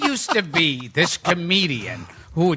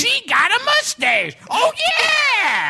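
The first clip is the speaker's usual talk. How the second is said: very high hollered punchline and tag; Freddie Rogers catchphrase; slower than usual. Retold or imitated. Imitating a comic saving a mustache joke, high shouted catchphrase. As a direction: yell it high, stretch the catchphrase. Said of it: "right after the punchline".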